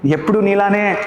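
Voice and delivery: ordinary delivery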